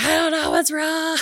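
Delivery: mock yell in a panic; retold panic after the fall; panicked scream. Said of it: "I was just, like, screaming".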